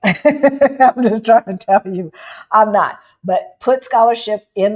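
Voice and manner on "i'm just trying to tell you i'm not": loud, laughing through the words; amused and emphatic; laughing admission. Real, not acted. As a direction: laugh it out, louder than usual. Laughs while admitting not working.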